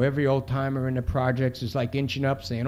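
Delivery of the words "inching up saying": plain reading voice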